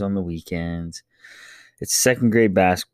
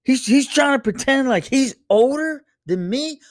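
put-on higher exasperated kid voice; indignant kid. Older son protests to dad about little brother, high and exasperated. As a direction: whine like the outraged older brother.

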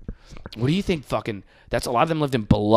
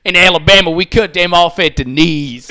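loud, raised-pitch heckle; imitated heckler; 5 semitones higher, 11 dB louder than usual. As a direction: call it out like a heckler from the sidelines. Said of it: "getting heckled on the side".

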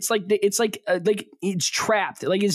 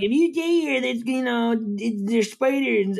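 imitating Nick; 4 semitones higher than usual. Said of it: "Nick's like".

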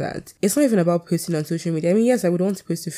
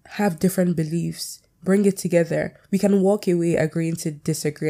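usual speaking voice

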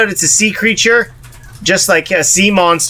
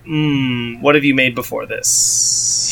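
skeptical hmm; 6 semitones lower, 4 dB quieter, slower than usual. Retold or imitated. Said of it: "it's like".

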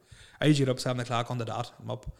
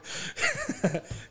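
high laugh. Real, not acted. Laughing at own story.

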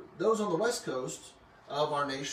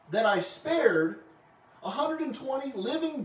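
indignant rebuke; 7 semitones higher than usual. Retold or imitated. God rebuking Jonah, retold.

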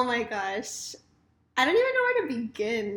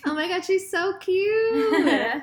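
gushing; 7 semitones higher, 5 dB louder, slower than usual. Real, not acted. Host gushing over her guest.

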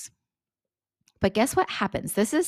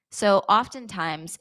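ordinary delivery

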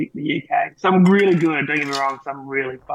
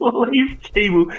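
drawn out, disbelieving repeat; incredulous slow echo; 8 semitones higher, 5 dB louder, much slower than usual. Real, not acted. Echoing the slur his friend just said, appalled and slow. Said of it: "Who comes up with this shit?".

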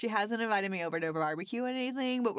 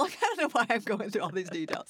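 normal talking voice

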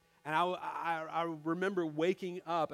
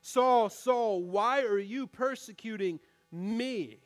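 loud call, then a slower question; 5 dB louder, slower than usual. Retold or imitated. Jesus stops Saul on his journey, loud call. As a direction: stop him in his tracks with the name. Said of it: "stops Paul in the middle".

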